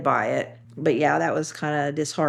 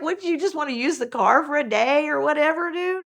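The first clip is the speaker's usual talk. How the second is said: incredulous laugh; 10 semitones higher than usual. Retold or imitated.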